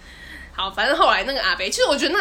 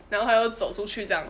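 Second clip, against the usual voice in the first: ordinary talk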